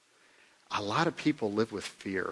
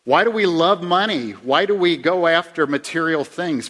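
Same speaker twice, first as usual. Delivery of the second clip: preaching loud; 6 semitones higher, 9 dB louder than usual. Real, not acted. Preaching rhetorical questions at full force.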